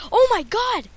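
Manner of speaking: high, loud exclamation; loud, high-pitched dismay; dismayed outcry. Real, not acted. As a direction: cry out at a sudden game death. Just got killed in game.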